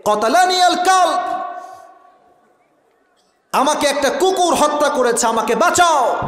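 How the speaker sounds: high-pitched acted cry for help. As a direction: cry out as the stricken man in the story. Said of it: "চিৎকার দিয়ে বলছে".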